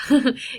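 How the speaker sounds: quick real laugh at herself; one short amused laugh. Real, not acted. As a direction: burst out laughing briefly. Laughing at her own mispronunciation.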